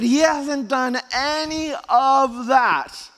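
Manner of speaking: loud, high, hammering list; impassioned preaching. Real, not acted. Preacher lists what Jesus hadn't yet done, impassioned preaching. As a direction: preach it at full force, stretching the last words.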